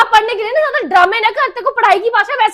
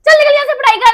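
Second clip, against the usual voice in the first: angry scolding; 6 semitones higher, 6 dB louder than usual. Acted. Mother scolds daughter.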